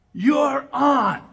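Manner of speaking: loud, high challenge accepted; defiant shout. Retold or imitated. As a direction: throw it back as a dare.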